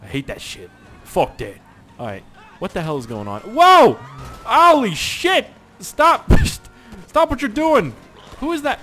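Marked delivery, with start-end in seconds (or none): 3.4-8.8 high, loud, alarmed yells; panicked yelling. Real, not acted. Gamer yelling as the tricycle careens out of control. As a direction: scream at the chaos. Crashing during a pursuit.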